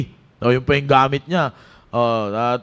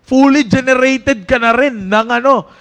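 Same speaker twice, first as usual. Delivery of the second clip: raised, urgent preaching to the youth; 4 semitones higher, 4 dB louder than usual. Acted. Youth pastor exhorting Team Focus, preaching with force. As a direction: drive it home to the young people.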